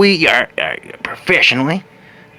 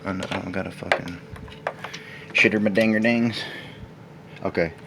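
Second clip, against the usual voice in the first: ordinary talk, nothing marked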